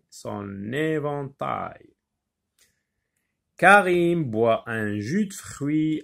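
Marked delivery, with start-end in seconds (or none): 0.1-1.9 "son éventail" said slowly with the N linked onto "éventail"; liaison shown. Shown as a demonstration. Teacher isolating the liaison for pupils, slow and clear. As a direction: stress the N sliding into the E of "éventail". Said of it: "la liaison N avec E".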